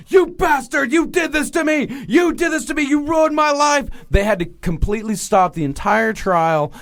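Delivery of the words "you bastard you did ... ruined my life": high-pitched retold screaming outburst; hammed-up high scream of accusation; imitated screaming. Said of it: "started screaming like".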